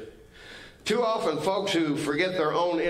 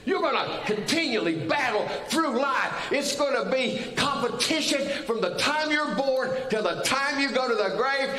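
preaching shout; 10 semitones higher than usual. Real, not acted. Preacher shouting to the congregation about competition. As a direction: drive the point home, shouting.

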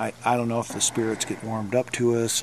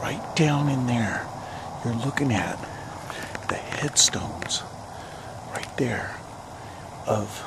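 hushed graveside whisper; 6 dB quieter, slower than usual. Real, not acted. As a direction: whisper so you don't disturb. Quiet at the graves.

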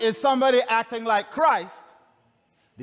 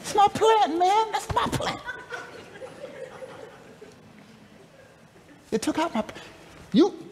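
whiny Jonah; 8 semitones higher than usual. Retold or imitated. Jonah whining about plant.

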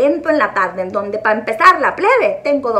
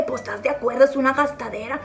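ordinary delivery

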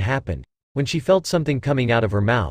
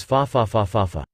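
maniacal laugh; 2 semitones lower than usual. A narrator's reading. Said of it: "The dragon breaker laughed maniacally".

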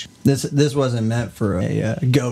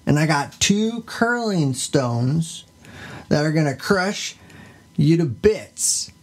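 higher, swaggering tough-guy boasts; gangsta goat; 4 semitones higher than usual. Pretend. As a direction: brag like a street thug making threats. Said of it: "He's a gangsta".

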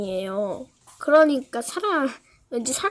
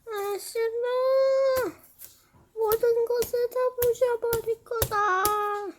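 sleep talking; 9 semitones higher, much slower than usual. Pretend. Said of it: "저 모르고 잠꼬대 했어요".

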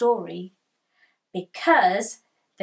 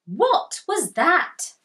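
startled; 6 semitones higher, 4 dB louder, slower than usual. Acted. Startled child in the woods.

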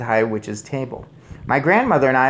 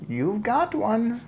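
high, slow, composed reply; unruffled grandmother; 11 semitones higher, slower than usual. A narrator's reading. Grandmother answers shrieking father, unruffled. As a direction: answer his panic placidly.

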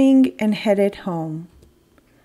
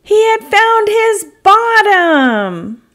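high, loud, slow triumph; triumphant; 12 semitones higher, 6 dB louder, slower than usual. A narrator's reading. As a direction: announce it with joy. Triumphant climax.

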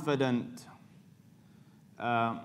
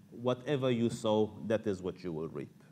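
ordinary delivery

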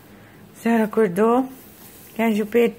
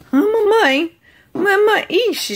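high loud cooing; excited high baby talk to her daughter; 10 semitones higher, 4 dB louder, slower than usual. Acted. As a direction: call mama in baby talk.

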